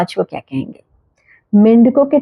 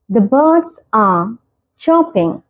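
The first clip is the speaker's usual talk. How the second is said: very slow, each word spaced out; slow clear example; much slower than usual. Shown as a demonstration. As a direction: model the sentence slowly for learners. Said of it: "example sentence read slowly". (धीरे, साफ़ उदाहरण वाक्य)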